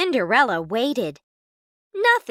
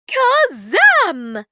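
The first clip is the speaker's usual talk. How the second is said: magic shout; 10 semitones higher, 6 dB louder than usual. A narrator's reading. Casting a spell.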